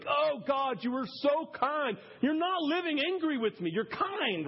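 a preacher reliving a moment of awe; grateful prayer. Retold or imitated. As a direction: marvel at God, lifting up. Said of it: "a big moment for me".

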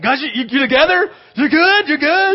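loud, high check-in to the catchers; calling out. Retold or imitated. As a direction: call down to the kids to check they're ready.

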